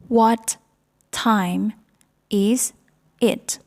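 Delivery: each word of "What time is it?" said separately with a pause after it; slow classroom drill, one word at a time. Shown as a demonstration. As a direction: break "What time is it?" into single spoken words. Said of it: "teacher drills the question word by word".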